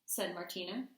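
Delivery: storytime read-aloud with hushed suspense; gentle picture-book narration; reading to children. A narrator's reading. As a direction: read it like bedtime story time for the class. Teacher reads story aloud.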